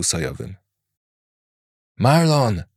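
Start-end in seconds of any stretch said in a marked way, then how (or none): 2.0-2.6 hushed but urgent call; stage whisper. A narrator's reading. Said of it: "Scenicznym szeptem i z powagą zawołał Andy".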